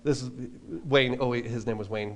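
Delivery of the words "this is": a light, lowered Australian twang for the road manager; lightly imitating the Australian road manager; terrible Australian impression. Road manager telling the band how to dodge visas, lightly put-on Australian accent. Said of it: "I'm gonna do a terrible impression of him".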